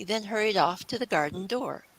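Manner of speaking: plain reading voice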